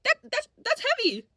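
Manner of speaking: high, stammering disbelief; shocked stuttering. Retold or imitated. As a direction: trip over the words in comic shock. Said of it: "Got me stuttering it was that heavy".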